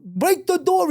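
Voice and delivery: high-pitched mock yell of command; urgent order. Urgent order, acted in story.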